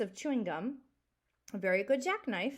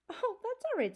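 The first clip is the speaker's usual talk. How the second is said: put-on much higher, cheerful boy voice; boyish Elmer; 13 semitones higher than usual. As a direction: play the boy brushing off thanks.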